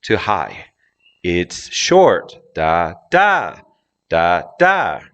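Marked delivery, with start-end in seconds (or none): 1.2-2.3 "short" punched higher than "It's"; low to high. Shown as a demonstration. Teacher demonstrating the pattern to learners, stress on "short". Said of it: "notice how it goes from low to high". 2.5-5.0 first "da" low, second "da" high; low-high beat. Shown as a demonstration. Teacher tapping out the tune for learners, low-high. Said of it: "how it goes from low to high".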